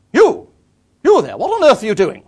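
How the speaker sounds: booming, indignant shout along the towpath; stentorian bellow. Retold or imitated. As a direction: bellow it like a countrywoman hailing a stranger from afar. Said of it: "a stentorian voice, sort of bellowing".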